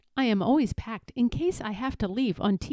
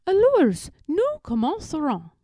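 high, loud first word, falling ends; exasperated tutor; 7 semitones higher, 4 dB louder than usual. A narrator's reading. Tutor starting French lesson with Eloise, high and insistent. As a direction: call the pupil to French lesson. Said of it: "what makes Philip angry".

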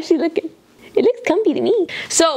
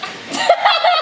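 high laugh. Real, not acted. Owner laughing at her dogs.

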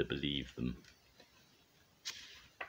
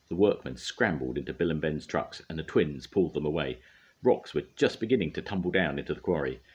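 plain reading voice

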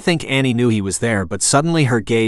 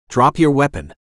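higher, mildly raised stern order; stern command; 4 semitones higher than usual. A narrator's reading. Officer ordering an armed woman to disarm, mild stern shout. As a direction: narrate a restrained shouted command. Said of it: "he shouted in a stern voice".

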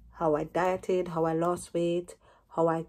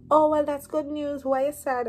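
higher, louder cheerful news; happy brother; 8 semitones higher, 4 dB louder than usual. Retold or imitated. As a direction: brighten up at the good news. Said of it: "So he, he was like".

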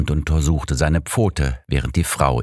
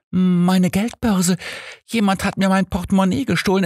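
much higher, panicked, stammering. A narrator's reading. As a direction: blurt it out in shock. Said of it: "fing an zu stottern".

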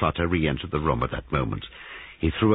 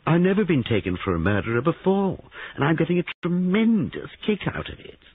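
high, playful and excited; teasing young man; 13 semitones higher than usual. A narrator's reading. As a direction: relish the joke, voice pitched high. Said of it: "whirled round on her and laughed".

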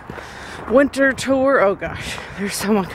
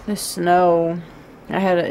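ordinary talk

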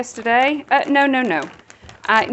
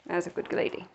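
much softer, tender praise; 7 semitones lower, 11 dB quieter than usual. Real, not acted. Owner softly praising the sitting dog, tender. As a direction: murmur it to the dog.

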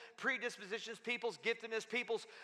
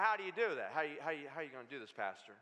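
imitating a doubter; 8 semitones lower, faster than usual. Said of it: "And some of you going".

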